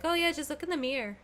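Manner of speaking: put-on American accent with "mirror" as "meer"; cousins' American accent. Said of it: "my cousins say mirror like that".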